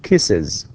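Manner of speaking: kisses said as KISS-iz, with an extra iz syllable; pronounced kisses. Shown as a demonstration. Teacher showing how kisses sounds to the class, demo. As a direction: say kisses with a clear iz ending. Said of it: "in pronunciation we say".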